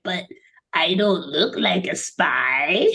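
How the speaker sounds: small, slow whispery alien voice; breathy little creature, almost whispered. Acted. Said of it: "in his little alien voice".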